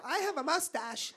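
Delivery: high, soft confiding voice; embarrassed woman act. Retold or imitated. Voices shy salon customer.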